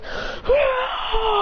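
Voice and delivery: high, drawn-out strained scream; high transformation yell; acted beast scream. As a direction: scream as a raging fire beast.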